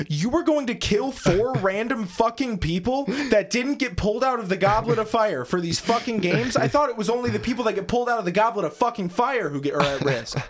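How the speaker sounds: high-pitched, exasperated disbelief; incredulous rant. Real, not acted. Host ranting to friend about absurd plot, incredulous.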